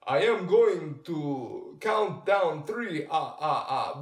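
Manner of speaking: hammed-up cereal vampire counting down; Count Chocula voice. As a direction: boom it out like a cartoon count. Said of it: "the character speaks like".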